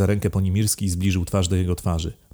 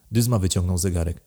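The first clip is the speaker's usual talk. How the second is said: ordinary delivery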